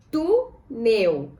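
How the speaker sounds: TÚ, pause, NEL; "Túnel" as tú... nel, held apart; syllable by syllable. Shown as a demonstration. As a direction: split "Túnel" into two separate syllables. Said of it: "Vamos separar em sílabas".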